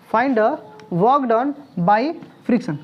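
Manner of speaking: very slow, drawn out for writing; slow dictation. Shown as a demonstration. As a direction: dictate each word slowly. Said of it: "लिख लो, लिख लो sir".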